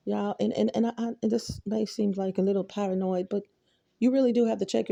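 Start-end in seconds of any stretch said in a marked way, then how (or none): none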